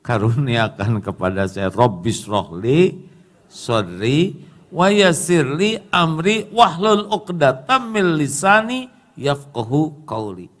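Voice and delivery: slow chanted Arabic prayer; prayer recitation. Acted. Preacher reciting Musa's prayer to the congregation, slow chant. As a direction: recite it like a supplication.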